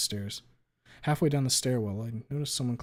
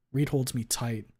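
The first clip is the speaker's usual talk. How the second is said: plain reading voice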